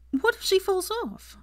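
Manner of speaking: high worried exasperation; exasperated. A narrator's reading. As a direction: fret at them, exasperated. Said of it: "still exasperated".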